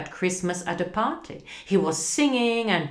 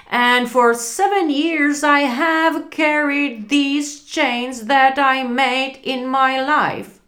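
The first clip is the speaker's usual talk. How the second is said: unearthly voice; 7 semitones higher, 6 dB louder than usual. Acted. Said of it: "Tenhle můj ghost teď mluví jinak".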